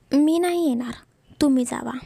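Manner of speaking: ordinary delivery, nothing marked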